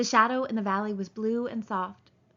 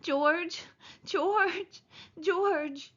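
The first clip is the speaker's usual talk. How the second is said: calling out to George in despair; Lenny crying out for George; 9 semitones higher, much slower than usual. A narrator's reading. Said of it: "And he cried".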